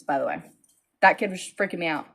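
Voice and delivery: normal talking voice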